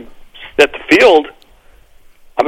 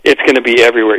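normal talking voice

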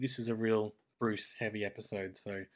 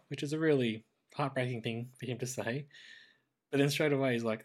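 ordinary delivery

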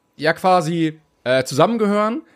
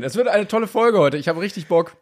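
ordinary talk

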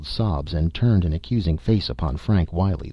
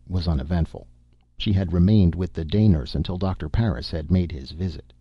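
ordinary delivery, nothing marked

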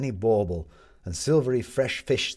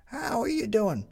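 put-on high girl, motherly; parental tone. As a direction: talk down kindly to a kid. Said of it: "adopting a parental tone".

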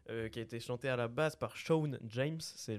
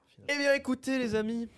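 beaucoup plus aigu et fort, ton d'animateur; clôture enthousiaste; 12 semitones higher, 9 dB louder, faster than usual. Acted. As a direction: lancer la fin de l'émission avec entrain. Annonce la fin du podcast. (animateur qui salue ses invités, enjoué et fort)

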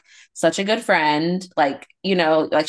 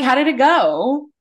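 mock polite question; 8 semitones higher, 4 dB louder than usual. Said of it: "You're like".

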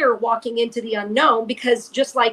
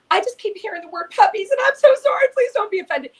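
nervous apology; 8 semitones higher than usual. Retold or imitated. Apologizing nervously to elderly woman.

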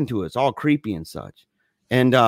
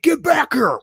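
high, retold yell after fleeing kids; angry chaser's yell; 13 semitones higher, 4 dB louder than usual. Driver chasing the kids through the park, angry yell. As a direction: imitate a man calling out mid-pursuit.

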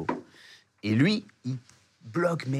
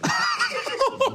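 burst of high laughter. Real, not acted. Laugh in the story.

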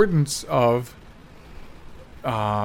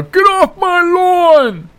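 put-on high, slow shout of a cranky old lady; 13 semitones higher, 5 dB louder, much slower than usual. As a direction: yell it like a grumpy old woman on her porch. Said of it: "You talk like an old lady".

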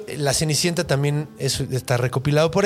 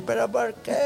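higher-pitched, softer pleading why; whiny protest; 6 semitones higher, 5 dB quieter than usual. Retold or imitated. Stepdaughter protesting to her stepmother, plaintive whine. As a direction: plead as the put-upon stepdaughter.

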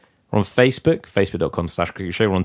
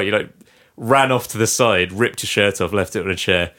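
ordinary talk, nothing marked

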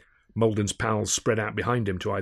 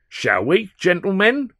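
mock courtesy; 10 semitones higher, 7 dB louder, slower than usual. Malden inviting mates to attack.

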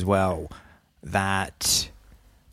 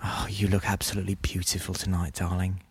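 quieter, tender date voice; soft romantic purr; 8 dB quieter than usual. Retold or imitated. Quoting a romantic ASMR date video, soft and tender. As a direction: coo it like a sweet date.

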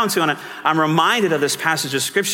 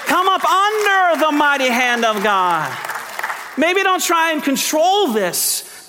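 rising preacher's appeal, higher and slowing; preaching at full force; 9 semitones higher than usual. Acted. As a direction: drive the exhortation home from the pulpit. Preaching climax.

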